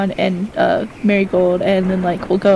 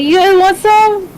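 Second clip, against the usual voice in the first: cheery offer; 10 semitones higher, 5 dB louder, slower than usual. Acted. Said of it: "I'm like".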